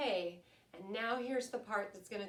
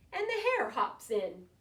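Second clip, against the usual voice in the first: lively, sing-song picture-book reading, louder than her chat; storytelling to children; 7 dB louder than usual. A narrator's reading. Teacher reading a rhyming bedtime book to her class, lively storytelling. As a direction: read it like story time for little ones.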